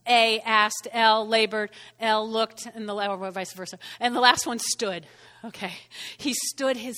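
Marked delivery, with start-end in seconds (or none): none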